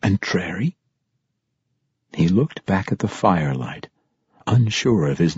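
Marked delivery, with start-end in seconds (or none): 0.0-0.8 soft, low question; hushed questioning murmur; under his breath. A narrator's reading. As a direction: mouth the name quietly to yourself. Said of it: "he mouthed under his breath".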